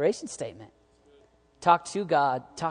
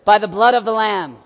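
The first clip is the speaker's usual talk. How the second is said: much louder and higher proclamation; full preaching shout; 6 semitones higher, 12 dB louder than usual. Real, not acted. Preaching climax.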